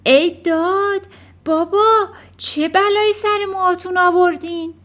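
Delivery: high, loud cry of dismay; shocked shout. A narrator's reading. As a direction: son yelling in shock at dad. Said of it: "پسر فریاد زد".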